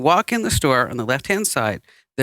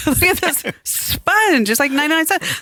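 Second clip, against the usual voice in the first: excited gushing through laughter; 10 semitones higher than usual. Retold or imitated. In the store, gushing high and laughing over prices. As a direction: marvel at every cheap item. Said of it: "I'm going like".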